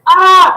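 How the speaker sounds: sudden high, loud shriek of fright; short panicked yelp; scared scream. Retold or imitated. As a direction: let out a startled scream. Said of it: "And that night I was like".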